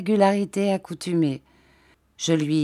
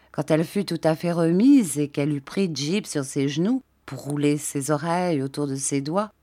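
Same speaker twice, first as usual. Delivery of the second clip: ordinary delivery, nothing marked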